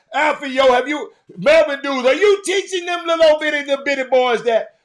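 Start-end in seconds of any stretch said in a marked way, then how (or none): 1.4-4.8 shout. Real, not acted. Shouting at coaches.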